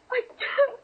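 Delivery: breaking into a sob; crying. Acted. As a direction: voice cracks into tears. Nurse breaking down after fight.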